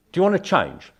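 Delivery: loud, high, insistent question; blunt challenge. Acted.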